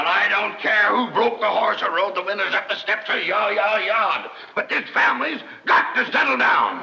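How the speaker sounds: exasperated bellow, pitch climbing high. Acted. Fed-up father calling his family to order, bellowing. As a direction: bellow over everyone, building to the last words. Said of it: "Will you stop bellowing?".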